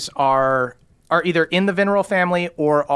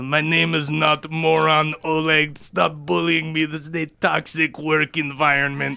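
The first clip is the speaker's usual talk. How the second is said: lightly voiced whiny dwarf complaining; a hint of a bullied tinkerer; hunchback dwarf.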